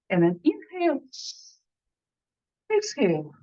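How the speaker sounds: calm, paced breathing instructions with pauses; soothing breath-work guide; guided breathing exercise. Acted. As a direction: coach the group through each breath.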